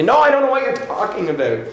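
mildly flustered protest; insistent denial. Acted. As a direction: deny it quickly, pitch jumping up. Acted Peter hotly denying Jesus.

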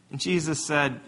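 ordinary talk, nothing marked

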